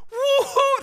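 high loud whoop; cheering crowd. Acted. The nation cheering David's win, high whoop. Country celebrating.

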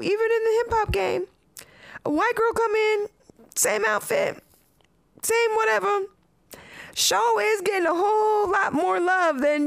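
rising indignation, pitch up high; worked-up rant. Real, not acted. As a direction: push it up as you get fired up. Heated point about hip hop.

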